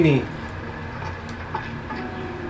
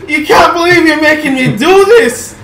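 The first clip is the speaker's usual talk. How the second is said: loud, high-pitched hammed-up dismay; mock horror; 8 semitones higher, 5 dB louder than usual. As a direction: wail in overdone disbelief. Mock horror at having to boy it.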